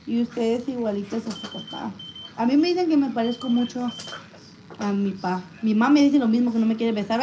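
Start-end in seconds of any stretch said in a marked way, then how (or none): none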